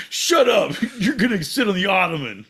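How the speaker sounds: loud, high shout breaking into laughter; mock outburst. Friend silences another over the foyer joke, mock yell. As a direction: yell it in play, laughing midway.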